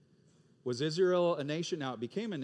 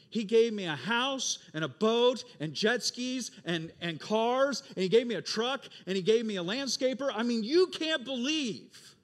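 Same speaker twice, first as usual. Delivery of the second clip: higher, louder gushing praise; excited bragging; 6 semitones higher, 6 dB louder than usual. Acted. Worker to the boss's son, gushing about gifts. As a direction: gush over every gift, slowing on the big ones.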